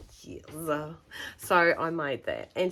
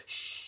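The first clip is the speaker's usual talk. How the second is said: drawn-out hushing "shh"; retold long, voiceless hush; long shush; 10 dB quieter than usual. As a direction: shush someone conspiratorially. Retells hushing the shop girl.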